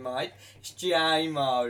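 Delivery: drawn-out Australian twang; mock Aussie pronunciation, slow; Australian accent. Shown as a demonstration. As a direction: stretch it out in a put-on Australian accent. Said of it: "say it with an Australian accent".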